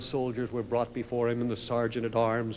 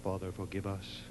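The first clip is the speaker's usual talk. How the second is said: prayer; 3 semitones lower, 5 dB quieter than usual. Acted. Leads a prayer.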